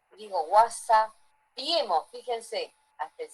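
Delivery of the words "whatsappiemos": whatsappiemos dicho despacio, marcando la ie; dialect pronunciation. Shown as a demonstration. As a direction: pronuncia whatsappiemos lento, estirando cada sílaba. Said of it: "hasta la fonética cambió". (demostración lenta de whatsappiemos)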